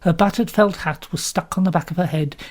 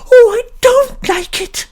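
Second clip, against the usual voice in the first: much higher, slow, louder plaintive wail; plaintive cry; 8 dB louder, slower than usual. A narrator's reading. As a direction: whimper out in fear. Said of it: "cried out plaintively".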